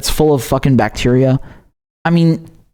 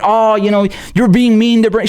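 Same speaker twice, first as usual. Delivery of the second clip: whiny mock critic; 6 semitones higher than usual. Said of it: "don't come around and tell me that".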